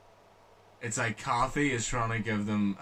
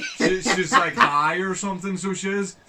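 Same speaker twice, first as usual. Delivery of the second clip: higher, louder, laughing through the words; 8 semitones higher, 9 dB louder, slower than usual. Real, not acted. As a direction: giggle as you describe her. Laughing while describing character.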